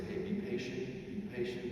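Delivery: soft, hushed patient coaching; calm a restless learner softly; gentle reassurance. Acted. Jesus coaches you at the fishing reel, soft and patient.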